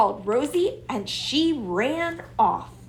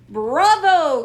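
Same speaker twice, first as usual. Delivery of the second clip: calling out; 7 semitones higher, 7 dB louder than usual. A narrator's reading. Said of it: "called Robert".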